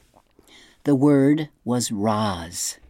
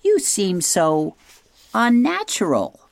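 screech; 8 semitones higher than usual. A narrator's reading. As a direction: draw it out into a high owl screech. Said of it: "screeched the owl".

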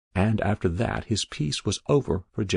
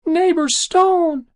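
tiny piping voice, very high and slow; squeaked; 8 dB louder, slower than usual. Acted. Trapped soul calling to neighbor Stone, squeaking. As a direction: squeal it out high, loud and desperate. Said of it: "it squeaked".

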